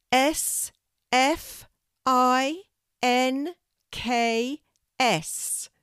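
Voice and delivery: high, slow letters, one at a time; tentative spelling out. A narrator's reading. Kelty guesses for the tree with fingers crossed, tentative. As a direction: offer each letter up high and drawn out.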